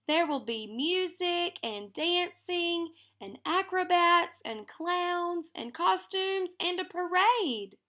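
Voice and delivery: put-on child dreaming up a parade, high and eager; excited. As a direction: let the dream run away with her. Lena's grand fantasy builds.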